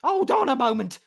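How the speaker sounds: halfling innkeeper calling for calm; halfling's call. Acted. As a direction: call out to stop them. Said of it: "Dainty said".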